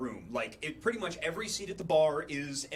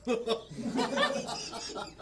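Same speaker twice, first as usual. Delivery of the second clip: a bright burst of laughter up high; 8 semitones higher than usual. Real, not acted. Laughing at own story.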